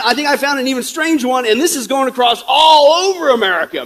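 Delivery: usual speaking voice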